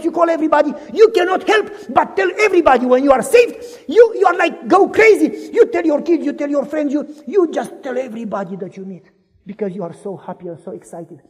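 normal talking voice

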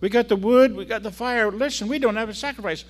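put-on very high, loud boy's voice, pleading; young Isaac. Isaac asking his father about the missing sacrifice, high boyish voice. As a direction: pitch it way up like an anxious young son. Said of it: "Isaac says".

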